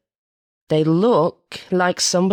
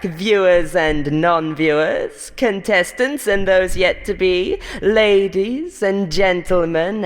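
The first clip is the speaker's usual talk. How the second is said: television announcer voice; 6 semitones higher than usual. Acted. Host welcoming viewers back, booming announcer. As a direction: boom it out like a TV host. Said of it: "flips from haughty contempt to a more stereotypical television announcer's timbre".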